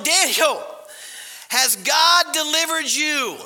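loud, high, drawn-out call; yelling. Acted. King yelling down to Daniel in the den, acted shout. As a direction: call out slowly as if into a pit. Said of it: "So he's yelling".

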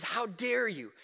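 "dare" pressed hard but softly, almost under the breath; a quiet, stunned reproach to the church; hushed indignant rebuke. Retold or imitated. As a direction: drop the voice and let the shock seep through. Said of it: "he says".

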